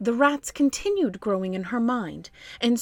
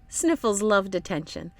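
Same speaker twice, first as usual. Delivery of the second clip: plain reading voice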